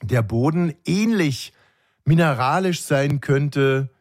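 quiz hostess. Retold or imitated. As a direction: stretch it out like a hostess quizzing her guests. Retelling the host's quiz question.

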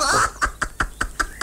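high breathy giggling; airy giggle. Acted. Dog laughs again.

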